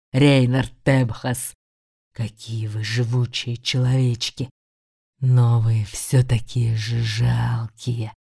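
low, slow hissing demon voice; creepy whisper. Acted. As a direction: hiss it out like a sneering demon. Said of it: "Просвистел он жутким шёпотом". (жуткий шёпот демона)

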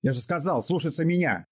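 roar, pitched high. A narrator's reading. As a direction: bellow the order. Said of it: "взревел он".

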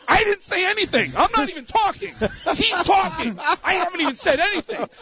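very high-pitched frantic squeal; hammed-up desperate protest; retold panicked denial. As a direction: squeal your innocence in mock panic.

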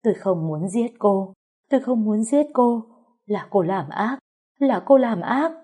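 a servant weeping that she never wanted to kill; tearful pleading. A narrator's reading. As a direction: sob out the excuse, rising in panic. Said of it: "tiếng người khóc than".

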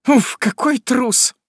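high, slow scornful sneer; с решительным презрением. Acted. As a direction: spit out utter contempt for the coward. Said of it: "тоном самого решительного презрения". (жена с презрением высоко и медленно)

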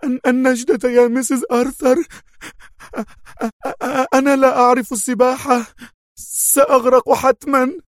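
drowning woman begging for rescue; screaming in terror. Acted. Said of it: "صارخة في رعب".

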